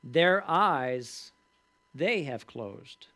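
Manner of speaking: "Their eyes" punched loud, then "they have closed" very slowly; emphasize the line. Shown as a demonstration. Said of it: "the point that I wanna emphasize".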